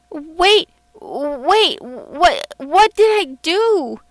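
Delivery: high, loud, panicky stammer; startled and scared, pitch jumping up; frightened, quivering. A narrator's reading. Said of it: "in a frightened, quivering voice".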